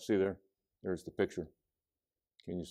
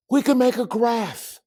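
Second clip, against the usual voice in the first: high, eager burst; excited idea; 4 dB louder than usual. A narrator's reading. Judy has an idea after counting ducks, high and excited.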